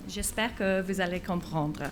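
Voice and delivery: halting French with an English accent; an English speaker getting by in French; broken French. Real, not acted. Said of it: "Je parle un peu français, mais pas assez". (français hésitant avec accent anglais)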